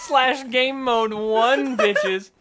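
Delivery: loud slow laughing command; laughing slow repeat. Pretend. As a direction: repeat it slowly, cracking up.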